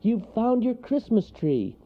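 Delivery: high, pleased little voice; delighted storybook character; glad cry. A narrator's reading. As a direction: exclaim it happily. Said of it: "cried Wharton".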